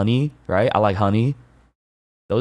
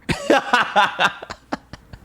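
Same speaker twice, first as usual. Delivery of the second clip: high laugh; 14 semitones higher than usual. Real, not acted. Laughs at burger analogy.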